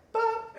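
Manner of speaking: very high cartoon "bop"; comic noise for the bone chip; pop sound effect. Imitating bone piece sticking out.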